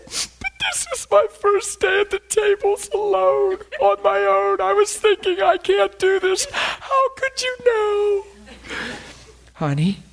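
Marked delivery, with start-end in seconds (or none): 0.0-8.2 high, loud, slowed crying voice; waitress sobbing about her first day alone; tearful outpouring. Retold or imitated. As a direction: let the words break through tears.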